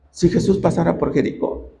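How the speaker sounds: hushed, low musing to himself; low, quiet wishful thought; wistful wondering. Acted. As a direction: say it softly, as a private hope. Blind man wonders to himself.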